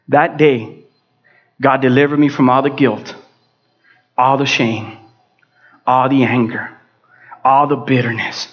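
low, measured list with long pauses; solemn testimony. Real, not acted. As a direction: let each burden drop separately.

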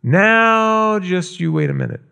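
lightly voiced grandfather soothing a child; mildly voiced grandpa calming his granddaughter. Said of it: "And Grandpa says".

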